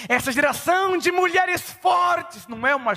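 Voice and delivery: loud, high preaching cry; preaching shout. Real, not acted. Pastor shouting the point to his congregation. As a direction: hammer it out to the church.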